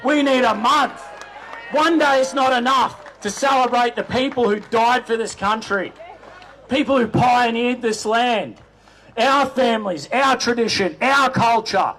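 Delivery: proud shouted rally address; rousing rally speech. Real, not acted. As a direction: fire up the crowd from the stage.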